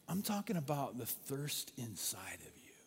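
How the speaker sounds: hushed, intimate whisper. Retold or imitated. Jesus gently telling the woman of inner thirst, whispered. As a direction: breathe it quietly.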